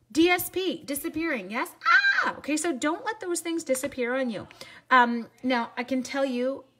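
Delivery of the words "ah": high, loud mock scream; mock alarmed cry. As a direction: shriek in pretend horror. Mock alarm at disappearing stamps.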